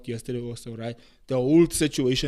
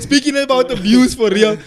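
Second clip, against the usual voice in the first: higher and louder, through laughter; laughing; 8 semitones higher, 8 dB louder than usual. Real, not acted. Host steers back to the topic after the joke, laughing. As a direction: try to move on while still cracking up.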